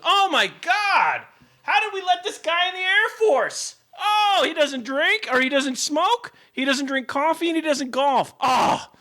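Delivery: high, slow, hammed-up dismay; squealing fake horror at the recruit; mock shocked recruiter.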